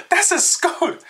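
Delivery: very high squeal on the edge of laughter; mock disbelief. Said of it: "I was like".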